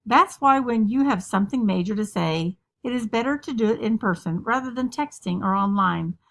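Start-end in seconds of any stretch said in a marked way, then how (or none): none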